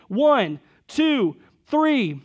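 building count before a group war cry; rousing countdown. Retold or imitated. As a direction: count off loud and high, spaced out. Preacher acts a countdown to a scream.

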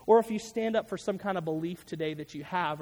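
ordinary delivery

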